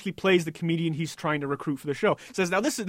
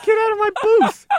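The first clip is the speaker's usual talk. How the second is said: much higher and louder, a hammed-up eviction; mock outrage; 8 dB louder than usual. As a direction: throw him out like an offended nativist, joking. Mock outrage at Armenian cohost.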